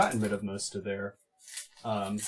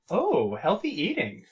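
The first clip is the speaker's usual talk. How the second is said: sarcastic; 8 semitones higher, 4 dB louder than usual. Retold or imitated. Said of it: "he's like".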